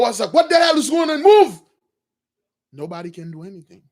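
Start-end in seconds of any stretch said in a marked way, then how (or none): 0.3-1.6 high, loud, rising to a yell on Move; furious shout. Retold or imitated. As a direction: yell at the frozen guards. Pharaoh shouts at frozen guards.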